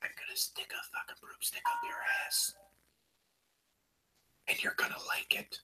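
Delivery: soft menacing whisper; eerie whispered threat; imitated whisper. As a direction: hiss it close and creepy like a psycho. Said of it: "And then he whispers again".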